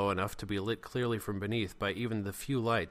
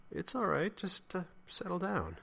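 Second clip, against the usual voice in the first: very high, hesitant reassurance; high-pitched soothing; 10 semitones higher than usual. Acted. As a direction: placate awkwardly in a high voice. Chris soothes Jeremy.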